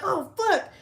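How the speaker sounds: high startled yelp of a curse; retold fright. Retelling the cat scaring her, high and startled. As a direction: act out being jumped by the cat.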